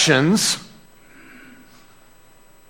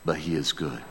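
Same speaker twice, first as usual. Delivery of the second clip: much softer and lower; hushed reverence; 12 semitones lower, 15 dB quieter than usual. Acted. The beaver reveals the lion's goodness, hushed. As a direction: drop to an awed hush. Hushed turn after the shout.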